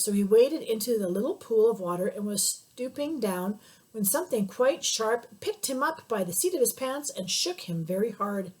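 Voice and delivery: ordinary delivery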